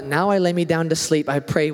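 put-on low, flat child's bedtime prayer; bedtime prayer recital. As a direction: rattle it off like a rote prayer. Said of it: "it's not kinda like".

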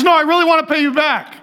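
high, pleading imitation of a woman; insistent shopper. Lady refuses a free gift, high mock pleading.

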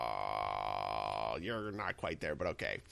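long, low drawn-out "oh", then softly conceding; low, hesitant mock letdown; underwhelmed reaction. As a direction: let it sag low and quiet, unconvinced. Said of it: "I was a little like".

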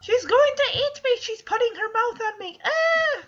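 very high, loud shrieking chick panic; panicking chick. Retold or imitated. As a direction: scream like a chick being swallowed by Godzilla. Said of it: "probably thinks, like".

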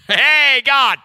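high, loud shouted call to God; bold lion call. Retold or imitated. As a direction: barge in calling out boldly. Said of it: "I come in like a freaking lion".

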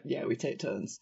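low, very quiet deadpan; underplayed, unenthused reply; not into it. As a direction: imitate a bored shop kid, dropping low and soft. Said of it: "the kid who wasn't into it".

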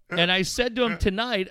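ordinary talk

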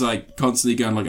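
usual speaking voice

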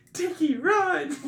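high, loud, stretched-out shout; mock urgent yell. Dad tells Dickie to run, mock urgent yell. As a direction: imitate yelling at a friend to flee. Dad acted urging friend to flee.

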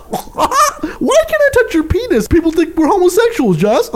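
high-pitched, laughing falsetto caricature; mock a friend in a silly high voice; giggly squeal, starting drawn-out; Waleed impression. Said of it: "Waleed clears his throat like this".

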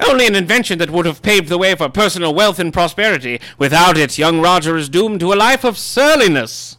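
high, loud, pompous gentleman voice; grand self-important literary heir; Christopher Tolkien voice. Acted. Holds the Tolkien character voice.

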